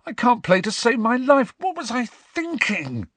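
high-pitched, panicky croak; despairing high croak of self-doubt. Acted. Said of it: "croaked Ron".

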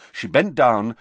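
usual speaking voice

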